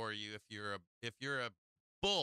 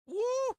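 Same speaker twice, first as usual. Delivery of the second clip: high, loud whoop; excited whoop; 9 dB louder than usual. Real, not acted. Trader cheers at the chart for his viewers, a loud high whoop. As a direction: let out a big high cheer.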